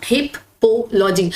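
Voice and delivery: हिपोलॉजी drawn out slowly as हिप, पो, logy; broken into parts. Shown as a demonstration. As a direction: pause after हिप, then पो logy, slowly. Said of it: "spelling को ऐसे break करके लिखो".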